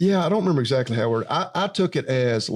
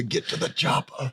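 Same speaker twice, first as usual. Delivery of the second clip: Arnold impression; 4 dB quieter than usual. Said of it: "I've never once needed to".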